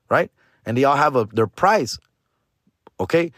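ordinary talk, nothing marked

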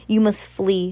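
usual speaking voice

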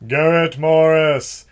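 slow, loud, booming proclamation; Zardoz voice. Acted. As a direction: intone the name like Zardoz. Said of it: "as in the voice of Zardoz".

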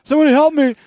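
high, loud hammed-up plea; mock victim in a commercial; pleading guy. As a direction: beg for help, high and loud.

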